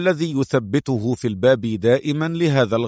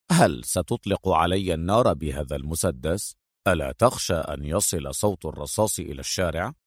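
low, soft, unruffled questions; smiling taunt; 6 semitones lower, 6 dB quieter than usual. A narrator's reading. Adham facing the young gunman, coolly smiling. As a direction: taunt him quietly with a slight smile. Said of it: "ابتسم أدهم وهو يقول للشاب".

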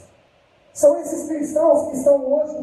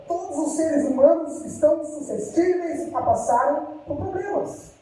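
ordinary talk

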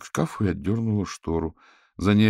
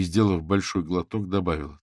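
ordinary delivery

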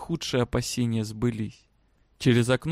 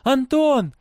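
high-pitched loud call; shout; 12 semitones higher, 6 dB louder than usual. A narrator's reading. Girl calling out for her father, shouting. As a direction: cry out the name, high and loud. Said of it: "крикнула она".

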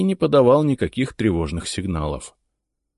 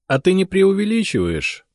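laughing; 13 semitones higher, 4 dB louder than usual. A narrator's reading. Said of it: "рассмеялся я".

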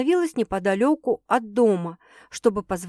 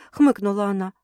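plain reading voice

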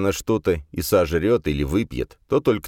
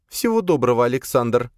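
higher, stern woman's farewell; frowning female voice; 7 semitones higher than usual. A narrator's reading. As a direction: voice Sofia frowning. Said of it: "нахмурилась она".

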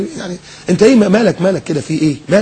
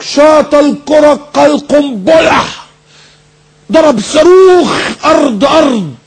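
high, stretched commentator shout; bombastic match commentator; 9 semitones higher, 3 dB louder, much slower than usual. Acted. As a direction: call the shot like a war report. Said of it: "يقول لك". (تعليق كروي حربي صارخ)

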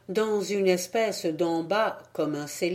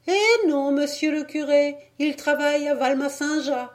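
much higher, louder woman's voice; village wife answering; 8 semitones higher, 3 dB louder than usual. A narrator's reading. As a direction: answer the priest at the door. (paysanne qui répond, voix aiguë)

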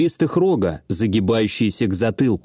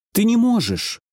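high alarmed protest; exclaiming; 9 semitones higher than usual. Acted. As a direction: cry out to stop him. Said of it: "воскликнула Варя".

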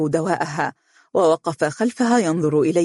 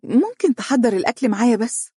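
higher-pitched, bashful asking; a shy wife's small request; 6 semitones higher than usual. A narrator's reading. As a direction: ask coyly, voice lifted. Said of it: "قالت بخجل".

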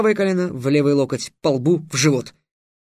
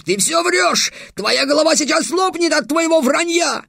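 furious shout; 12 semitones higher than usual. Acted. Alkhazab keeps screaming at Trix.

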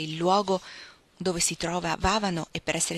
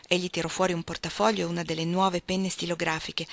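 ordinary delivery

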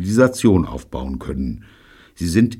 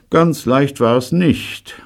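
stolz; 8 semitones higher, 5 dB louder, slower than usual. A narrator's reading. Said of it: "sagte er stolz".

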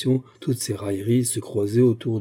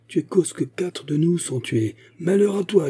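high, rising accusing cry; angry accusation; 8 semitones higher than usual. A narrator's reading. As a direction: hurl the blame at him. Survivors accuse and curse Biscara.

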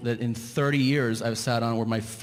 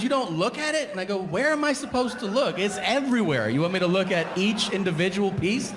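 incredulous; 8 semitones higher than usual. Retold or imitated. Quoted exchange, rising outrage.

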